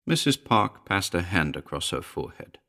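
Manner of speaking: ordinary delivery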